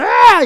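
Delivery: very high, loud staged cry; a showy cry of an actor at home; acted exclamation. Showing how she acted at home, a loud high cry. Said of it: "이래놓고 밖에 나왔어".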